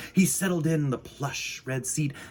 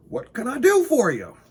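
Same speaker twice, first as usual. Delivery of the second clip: higher, louder friendly shopkeeper; cheerful Mister Winky; 12 semitones higher, 6 dB louder than usual. Acted. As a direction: greet him brightly and loud. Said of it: "said Mister Winky".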